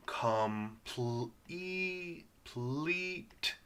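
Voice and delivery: COMPLETE broken up and stretched, held long EE; sounded out in parts. Shown as a demonstration. Teacher sounding out a word with magic E for learners, slowly stretched. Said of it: "This is E magic E".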